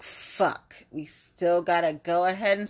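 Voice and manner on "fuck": unvoiced, under the breath; a whispered fuck under the breath; muttered curse. Real, not acted. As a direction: curse it quietly to yourself.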